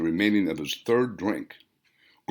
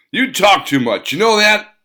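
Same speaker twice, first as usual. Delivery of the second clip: sharp irritated snap, rising louder; snapping; 10 semitones higher, 9 dB louder than usual. Acted. Hans snapping at Voltarion, loud irritated retort. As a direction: snap it at the babbling man. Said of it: "Hans snapped".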